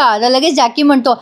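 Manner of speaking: normal talking voice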